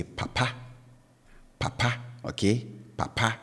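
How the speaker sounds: papa with a low first syllable and a high second; papa going up from low to high; low then high tone. Shown as a demonstration. Teacher repeating papa, father, low then high. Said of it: "low tone marker on the first syllable".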